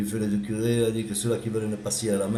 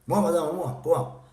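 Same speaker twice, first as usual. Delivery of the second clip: higher, eager hand-up call; eager pupil; 7 semitones higher, slower than usual. Acted. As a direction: pupil begging to be picked. (élève impatient, voix aiguë)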